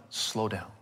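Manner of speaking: soft and low, calming; gentle slow down. Retold or imitated. God calming the frantic prophet, softly. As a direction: hush him gently. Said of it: "you can almost hear God saying".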